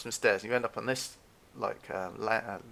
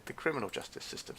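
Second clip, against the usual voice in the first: ordinary talk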